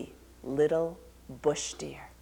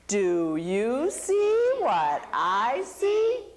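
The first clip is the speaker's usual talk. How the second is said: slow, sly singsong lure; sly singsong Anansi; 4 semitones higher, 4 dB louder, much slower than usual. Acted. Anansi luring Little Bush Deer to the rock, slow sly singsong. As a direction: draw the question out like bait.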